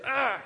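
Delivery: smaller, quick burst of exasperation; angry "ah". Retold or imitated. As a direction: re-enact a contained flash of anger. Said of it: "it wasn't that bad, but it was".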